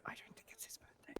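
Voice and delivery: whispered aside. Real, not acted. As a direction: whisper it to the group. Aside about birthday lie.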